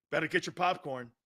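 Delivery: ordinary talk, nothing marked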